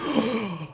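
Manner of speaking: big high gasp of surprise; acted wordless gasp; mock shocked gasp. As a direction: re-enact a stunned intake of breath. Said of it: "when I drew that card, I was like".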